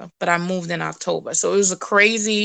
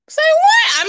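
high, loud incredulous yelp; shocked disbelief; 5 dB louder than usual. Retold or imitated. Woman reacting to hearing God on the bus, shocked disbelief. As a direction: blurt it out, much higher and louder.

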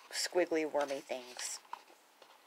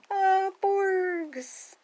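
high, loud gush of delight; high-pitched adoring squeal; delighted cooing; 14 semitones higher, 4 dB louder than usual. Real, not acted. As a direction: coo over something adorable, high and drawn out. Delighted at seeing porgs in book.

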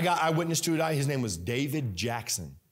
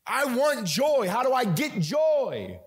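slow, high, loud preacher's cry; drawn-out fervent exclamation; impassioned plea; 10 semitones higher, 4 dB louder, slower than usual. Real, not acted. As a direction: stretch it out as you cry for it. Preacher crying out his longing.

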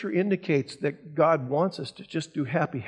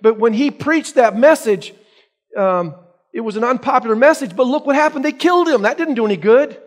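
higher, louder protest from an imagined listener; mock objector; 4 semitones higher, 6 dB louder than usual. Congregation member objecting to the pastor, mildly voiced, higher and louder. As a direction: push back at the pastor. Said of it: "And you're gonna say to me".

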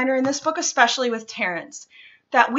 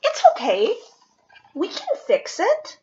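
higher, kind little-friend voice; reassuring friend; 10 semitones higher, faster than usual. Acted. Hermit crab comforting the turtle, higher reassuring voice. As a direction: comfort him in a small high voice.

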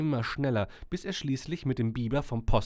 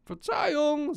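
high, raised call; calling from a distance; 5 dB louder, slower than usual. A narrator's reading. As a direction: call an apology from afar. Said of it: "sagte der Windstoß in einiger Entfernung".

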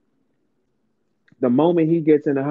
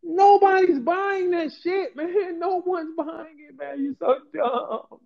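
laughing mockery; 10 semitones higher, slower than usual. Real, not acted. Laughing at the women's illusions.

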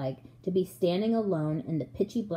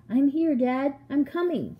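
higher, raised answering call; eager raised reply; calling back; 6 semitones higher than usual. A narrator's reading. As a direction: call out that help is on the way. Child calling back to father.

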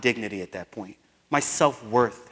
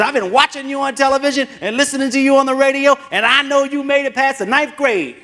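put-on old teacher voice; 13 semitones higher, 9 dB louder than usual. Imitating the old teacher's reply.

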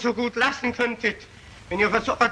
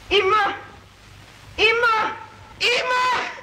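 each "immer" climbs higher in panic; desperate rising cries; 9 semitones higher than usual. Acted. Murderer to the mob, rising desperate cries. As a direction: voice climbing with every repeat.

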